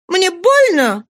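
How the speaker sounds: high, loud cry of protest; pained cry. A narrator's reading. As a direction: cry out sharply in pain. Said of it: "крикнула она".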